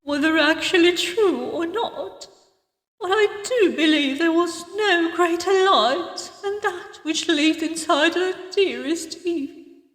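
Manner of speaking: put-on grieving old woman, holding herself together; mournful tribute fading into a soft "oh"; pained voice. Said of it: "pain still showing in her voice".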